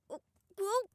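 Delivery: loud, high strained voice under a heavy load; straining. Acted. Sasha struggles to lift B B, loud strained voice. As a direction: grunt it out while lifting someone too big.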